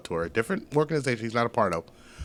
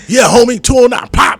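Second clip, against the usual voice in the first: loud, high hyped shout of a fan; mock hometown yell; 11 semitones higher, 15 dB louder than usual. Fighter shouting out his hometown to the crowd, loud hyped yell. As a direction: yell it out like a proud homeboy. Friend yelling hometown pride.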